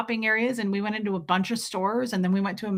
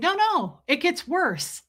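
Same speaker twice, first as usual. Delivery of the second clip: insistent; 8 semitones higher, slower than usual. Retold or imitated. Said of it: "And I said".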